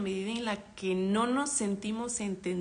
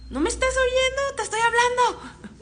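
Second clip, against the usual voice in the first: high, loud exasperated yell; shouting; 8 dB louder than usual. Retold or imitated. Parent acting a person ignored, yelling for attention. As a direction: scream to be heard, half laughing. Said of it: "Pues, gritas".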